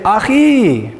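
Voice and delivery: Achi said ah-KHEE, called out warmly to a man in the room; Hebrew achi to a brother. Shown as a demonstration. Teacher models greeting a brother in the room, Achi as a call. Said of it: "look at a guy in the room and say".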